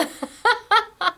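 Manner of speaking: a short high laugh; high giggle. Real, not acted. Laughing after praising Yvette, high giggle.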